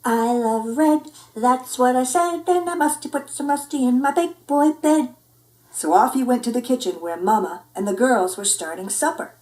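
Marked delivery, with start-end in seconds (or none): 0.0-5.1 a lightly higher, eager little cat; sing-song small boy's delight; kitty boy voice. Acted. Skippy's character voice.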